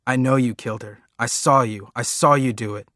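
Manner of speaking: loud desperate screamed accusation; boy screaming at his father to stop him. Acted. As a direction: scream it over and over. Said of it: "keep screaming".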